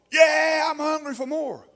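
high, loud mock shout of a churchgoer; mock congregant. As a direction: ham up an eager believer yelling. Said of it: "'cause we're so".

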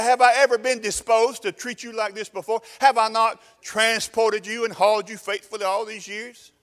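high, loud, indignant donkey; donkey's voice. Acted. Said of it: "She said".

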